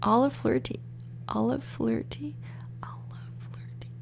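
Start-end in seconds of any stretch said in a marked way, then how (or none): 1.3-2.4 softly whispered name. Shown as a demonstration. Vlogger testing a baby name, whispering. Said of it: "repeated whispered". 2.8-3.9 barely audible whispered name; faint whisper. Shown as a demonstration. Vlogger trying out a name, faint whisper. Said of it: "repeated whispered".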